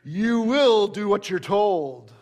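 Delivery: read-aloud mock shout, stressing will. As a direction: boss loses his temper, a restrained shout.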